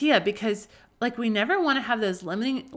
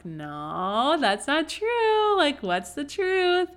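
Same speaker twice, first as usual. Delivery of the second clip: high, slow, far-off sing-song mom voice; slow and lilting, coaxing a kid back to the truth; a mother's high, drawn-out playful correction; mom correcting child; 7 semitones higher, 3 dB louder, much slower than usual. Retold or imitated. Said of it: "I'm like".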